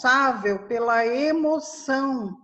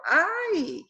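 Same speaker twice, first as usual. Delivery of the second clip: sharp higher "ai" of pain, a demo; cry of pain; 7 semitones higher than usual. Shown as a demonstration. Teacher showing the interjection of pain with a higher "ai". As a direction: yelp "ai" as if hurt. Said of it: "de dor".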